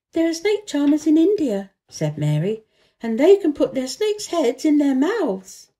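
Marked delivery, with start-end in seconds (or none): 0.1-1.7 lightly voiced Mary. A narrator's reading. 3.0-5.7 lightly voiced Mary. A narrator's reading.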